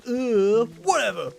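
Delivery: loud, high, exaggerated dismissive groan. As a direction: blow it off with a big mock-annoyed "whatever". Exaggerated retold dismissive reaction.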